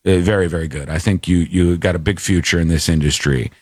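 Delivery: a hint of a deep-voiced radio boss giving notes; program director. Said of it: "he was like".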